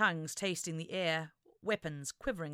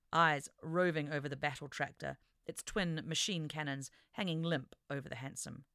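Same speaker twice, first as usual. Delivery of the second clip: usual speaking voice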